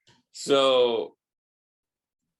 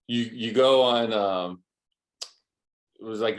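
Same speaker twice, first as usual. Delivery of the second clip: ordinary talk, nothing marked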